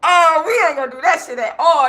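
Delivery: exaggerated loud no-way; loud mock refusal. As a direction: blurt it out over the family, half-joking.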